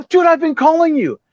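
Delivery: high-pitched exasperated complaint; retold annoyance. Stood-up bassist answering Piercy's late call, exasperated. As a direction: act out griping down the phone. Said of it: "I'm fucking pissed".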